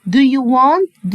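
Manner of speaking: teacher drawing out the question; slow question model. Shown as a demonstration. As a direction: Do you want to shake hands said slowly. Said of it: "Do هنا معناها هل".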